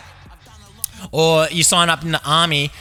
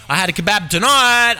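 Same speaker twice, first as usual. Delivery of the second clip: higher, emphatic proclamation; excited announcement; 5 semitones higher, 3 dB louder than usual. Real, not acted. Telling listeners why it's relatable, excited announcement. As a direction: announce it proudly, pitch lifted.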